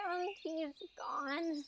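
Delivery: slow, very soft letdown; defeated, hushed aside; deflated. Acted. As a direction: let it sink away slowly and quietly. Prince left before she could object.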